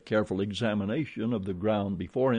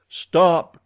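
sharp, loud cry, pitched high; shouted command; 7 semitones higher, 11 dB louder than usual. A narrator's reading. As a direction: yell it out to halt a charging beast. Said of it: "cried Tarzan".

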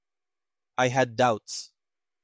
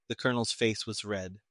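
usual speaking voice

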